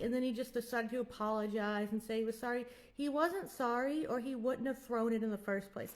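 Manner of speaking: usual speaking voice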